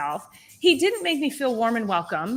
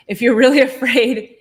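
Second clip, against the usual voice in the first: self-correcting with a laugh, louder; really said louder with a laugh before afraid; laughing through the word; 4 semitones higher, 10 dB louder than usual. Real, not acted. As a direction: laugh mid-phrase as you swap the word. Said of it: "I almost said scared".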